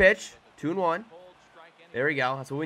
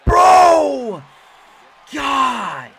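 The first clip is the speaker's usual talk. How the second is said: very high, loud cry of dismay; real long anguished shout; exasperated yell; 12 semitones higher, 13 dB louder than usual. Real, not acted. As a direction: yell in helpless frustration. Dismay at another bad play.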